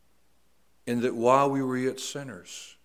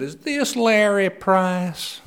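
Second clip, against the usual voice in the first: woman on phone; 9 semitones higher, 12 dB louder, much slower than usual. Retold or imitated. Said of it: "she said".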